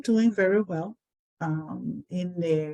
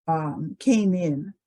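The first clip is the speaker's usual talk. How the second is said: normal talking voice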